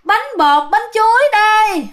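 high, drawn-out hawker's call; long singsong vendor cry, pitched high; ghostly street vendor's cry. Retold or imitated. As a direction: call out cakes for sale, slow and eerie. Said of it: "Bà kêu vậy đó các bạn".

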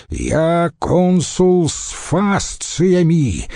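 high, drawn-out assertion of rank; thundering proclamation. A narrator's reading. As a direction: proclaim the office, stretching it out. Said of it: "прогремел обычно апатичный Павел". (громогласное заявление)

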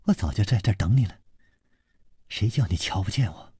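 quick, understated sneering taunt; cold sneer. Acted. Masked stranger taunting his pursuer, cold sneer. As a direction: say it with a cool, low-key sneer. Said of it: "一个人冷笑说".

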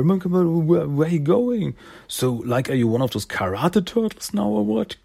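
high, bouncy slick-guy greeting; Silas voice. Acted. Silas greeting Jenny after the punch, high slick voice. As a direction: greet her like a smooth operator.

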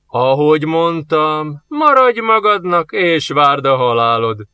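slow, menacing, rising on the middle; angry growl. Acted. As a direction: growl a warning at the old man. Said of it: "mordult dühösen a fegyveres".

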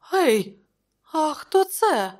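high, loud surprise; startled query. A narrator's reading. Counsellor noticing another corpse, startled. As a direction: break off with a high startled question.

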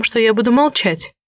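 plain reading voice

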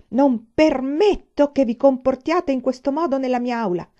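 stern indignation. A narrator's reading. Teacher grabs the sergeant by the arm, indignantly stern. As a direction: forbid it with a scowl in the voice. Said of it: "disse con cipiglio".

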